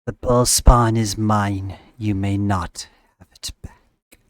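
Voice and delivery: menacing monster. Acted. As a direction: claim it like an ancient beast. GM voices snake monster.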